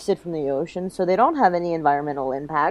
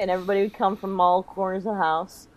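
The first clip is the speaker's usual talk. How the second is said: usual speaking voice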